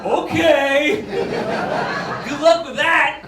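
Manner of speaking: loud, high mock encouragement; loud, sarcastic good luck. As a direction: dismiss them with sarcasm. Mocking Area 51 stormers.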